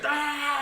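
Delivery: high-pitched "aaah" of someone hurling a chair; imitated yell. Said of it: "he chucked this chair".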